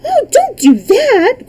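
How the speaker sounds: high, loud, drawn-out cry of dismay; horrified girl's outcry. A narrator's reading. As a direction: cry out in shock, pleading for it to stop. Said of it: "cried Dorothy".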